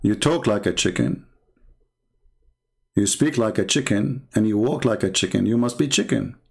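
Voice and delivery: deadpan low ridicule; low taunt. Real, not acted.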